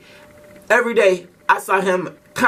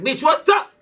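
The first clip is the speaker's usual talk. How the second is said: much higher, challenging yell; ready to fight; 8 semitones higher than usual. Retold or imitated. He leaps up at the bullies, challenging them to fight. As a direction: jump up and square off. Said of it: "I jump out my seat like".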